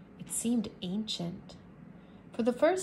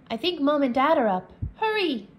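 urgent hurry; 4 semitones higher, 6 dB louder than usual. Acted. Urging brother to hurry home.

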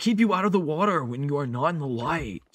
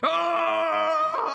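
shout; 13 semitones higher than usual. Real, not acted. Sudden cry while playing.

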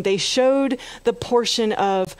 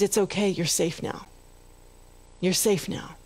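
softer, tender reassurance; reassuring doctor; 2 semitones lower, 5 dB quieter than usual. Retold or imitated. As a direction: quietly comfort a frightened man. Doctor comforts crying captain.

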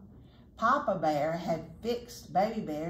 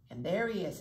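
plain reading voice

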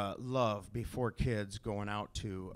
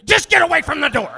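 much higher, much louder yell; panicked yell; 18 dB louder than usual. Acted. Dad yelling at kid standing behind restroom door. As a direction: yank him away with your voice.

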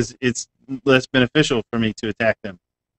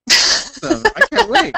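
squeaky voice; much slower than usual. Pretend. Squeaky excited quip.

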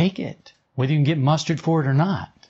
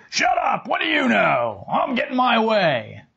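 high-pitched, hammed-up defiance; mock angry retort; 9 semitones higher than usual. Willful self silencing its conscience, hammed-up angry retort. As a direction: shout down the little voice in mock anger. Bossy self snaps at inner voice.

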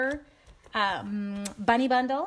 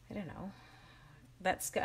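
usual speaking voice

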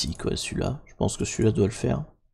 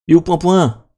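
louder, higher repeated question; raised-voice, exasperated search; impatient calling out; 6 semitones higher, 7 dB louder than usual. Real, not acted. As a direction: call out for the thing that's missing. Repeats it louder, cannot find it.

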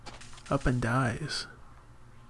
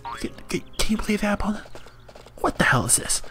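ordinary talk

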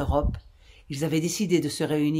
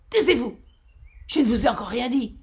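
angry command; 7 semitones higher, 4 dB louder than usual. A narrator's reading. Captain silencing mockers.

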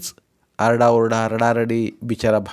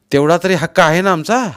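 raised, louder brotherly plea; insistent appeal; 8 semitones higher, 8 dB louder than usual. A narrator's reading. Brother insisting to his sister on his right to help, insistent. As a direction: press your claim as family.

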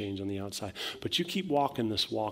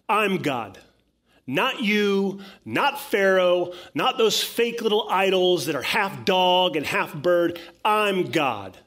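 booming, high-pitched declaration of supreme authority; God; 8 semitones higher, 8 dB louder than usual. Acted. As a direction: thunder it out like a preacher voicing the Almighty. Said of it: "God is saying to Moses".

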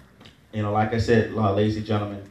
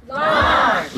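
shouted high and loud; 9 semitones higher than usual. Real, not acted. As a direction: call the word out to the room. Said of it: "Can y'all say launch really loud?".

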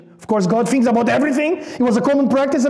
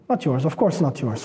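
mock reassuring aside; 9 semitones lower, 5 dB quieter than usual. Shown as a demonstration. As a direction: lower and softer, teasing "Not yours". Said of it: "Who would say such a thing?".

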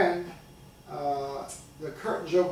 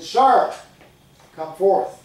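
commanding, much louder summons; loud call; 3 semitones higher, 10 dB louder, much slower than usual. Acted. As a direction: call him out across the church. Stranger calls preacher forward again.

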